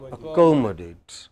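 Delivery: "Accommodate" with a strong COM; stress on COM. Shown as a demonstration. Teacher answering how we pronounce it, stress on COM. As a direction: stress the second syllable of "Accommodate". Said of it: "two syllables before A T E".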